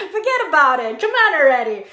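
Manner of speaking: hammed-up New York accent; rough, joking New Yorker accent; mock New York accent. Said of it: "That sounds more like, uh, New, New York".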